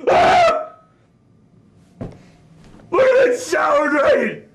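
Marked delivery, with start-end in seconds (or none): 0.1-0.7 high-pitched scream of disgust; comic horrified squeal; high yelp. Acted. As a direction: let out a sudden high shriek. Cries out at hated toilet.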